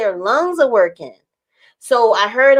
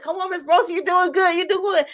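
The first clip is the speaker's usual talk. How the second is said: high, softer coaxing voice; encouraging medical assistant; 7 semitones higher, 4 dB quieter than usual. Retold or imitated. Assistant urging patient through the pressure, retold encouragement. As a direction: imitate someone coaxing a patient along.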